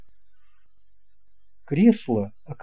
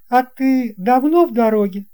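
put-on boy pilot's question; 9 semitones higher, 4 dB louder than usual. A narrator's reading. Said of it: "pilot asks".

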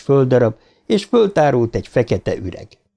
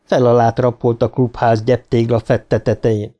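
usual speaking voice

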